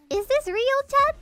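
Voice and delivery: high rising Is this real; mock streamer question. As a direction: ask chat with a big rise. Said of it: "a younger generation say".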